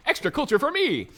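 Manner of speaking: high, loud, gleeful villain; jovial evil dictator. Pretend. Voicing Monty as jovial evil dictator, high gleeful gloating. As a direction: gloat happily over atrocities. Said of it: "he'd be like".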